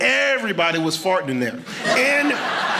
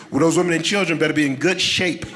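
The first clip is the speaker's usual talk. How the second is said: usual speaking voice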